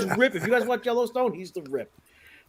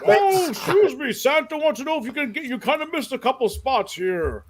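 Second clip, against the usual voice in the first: higher, sugary put-on Santa politeness; polite Santa voice; 7 semitones higher than usual. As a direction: Santa being overly polite through gritted teeth. Santa asking mom again.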